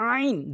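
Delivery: আইন squeezed from the middle of the throat; pressed throat ayn. Shown as a demonstration. Teacher showing how to say ayn, pressed from mid-throat. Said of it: "হলকের মাঝখান থেকে চাপ দিয়ে".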